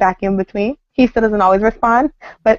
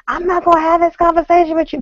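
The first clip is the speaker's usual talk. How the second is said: mimicking husband; 9 semitones higher than usual. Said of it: "type of thing".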